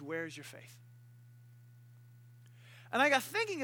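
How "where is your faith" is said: low, very soft question; subdued, sincere undertone; quiet reproach. Acted. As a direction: ask it gently, hushed. Jesus' gentle response, contrasted with boasting.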